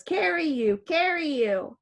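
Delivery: a hint of a small child asking; toddler voice. Baby points at the bicycle, lightly voiced toddler. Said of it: "Baby Enrico toddles over".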